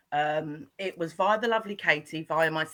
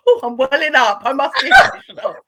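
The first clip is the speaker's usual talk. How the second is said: high, loud, laughing mock tears; laughing mock emotion; 5 semitones higher, 14 dB louder than usual. Guest recalling reading her own words, laughing high. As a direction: laugh through a pretend welling up. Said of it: "I was like".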